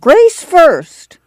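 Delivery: very high, insistent grandma cry; piercing old woman's clamor. A narrator's reading. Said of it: "Grandma clamored".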